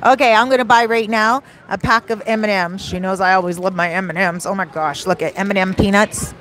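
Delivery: usual speaking voice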